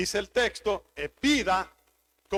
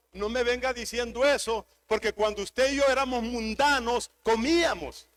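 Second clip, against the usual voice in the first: ordinary delivery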